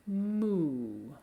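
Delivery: 'Mu' held long with a modest pitch fall; long, secondary-stressed 'Mu'. Shown as a demonstration. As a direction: stretch 'Mu', gliding gently down. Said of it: "It's not as high of a pitch change".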